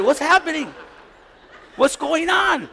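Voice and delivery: high, exuberant mock welcome; hammed-up friendly call; over-friendly greeting. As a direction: hail them like long-lost buddies. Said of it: "I'm a little too friendly".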